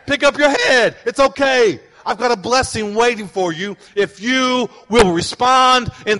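loud, driving sermon voice building to a shout; fiery preaching. Real, not acted. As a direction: lift the room, preach it out. Preaching at full force, voicing God.

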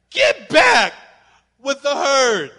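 loud, high calls to go back; calling at the TV. Retold or imitated. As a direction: wave the stray back to the herd. Yelling at TV animal.

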